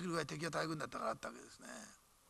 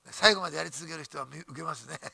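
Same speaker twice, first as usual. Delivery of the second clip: ordinary delivery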